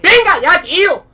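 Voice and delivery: very loud, high outburst; exasperated yell. Real, not acted. Gamer fed up with the game, exasperated yell. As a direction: explode with real frustration. Frustrated at being killed.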